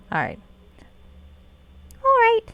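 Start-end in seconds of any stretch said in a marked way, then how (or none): 2.0-2.4 high, loud put-on pixie. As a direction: pipe it up like a pixie. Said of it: "got the pixie voice".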